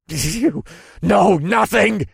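high, loud, drawn-out outburst; spat in fury. A narrator's reading. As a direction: spit the words at the enemy. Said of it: "the general spat".

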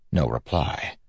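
ordinary delivery, nothing marked

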